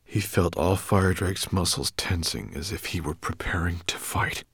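plain reading voice

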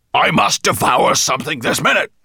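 loud, high roar of a ravenous monster; enraged dragon bellow. A narrator's reading. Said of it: "he bellowed".

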